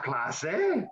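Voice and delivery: ordinary talk, nothing marked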